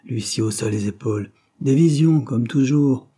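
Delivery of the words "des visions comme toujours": louder and higher, brushing them off; scoffing dismissal. Acted. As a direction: scoff at their visions. Usher scoffs at the agents.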